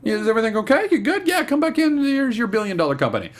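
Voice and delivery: high, gushing welcome-back voice; mock welcoming staff. Host mimicking office staff greeting Danny, high and gushing. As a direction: gush like fawning employees. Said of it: "everyone will be like".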